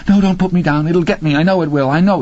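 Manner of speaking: high frantic begging; cling and beg, terrified; terrified pleading. A narrator's reading. Laverne begging Randy not to put her down, high frantic pleading.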